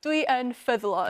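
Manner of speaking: Dwi yn ffyddlon said in native Welsh; Welsh phrase. Shown as a demonstration. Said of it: "how'd you say it?".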